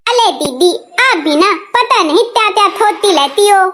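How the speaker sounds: put-on childlike lisp, r turned to l; tongue-tied daughter-in-law, softened consonants; lisping baby talk. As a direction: lisp it like a toddler. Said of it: "अभी से ततलाने लगी".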